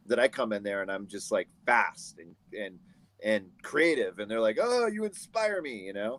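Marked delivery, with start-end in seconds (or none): 4.6-5.8 high, drawn-out mock gushing; swooning retold praise, pitched way up; gushing admiration. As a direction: imitate a starstruck admirer, slow and high. Retelling admirers on set.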